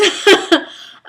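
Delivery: high, bursting little laugh; embarrassed laugh. Real, not acted. Runner apologizing to viewers for crying, embarrassed laugh. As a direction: laugh off the tears.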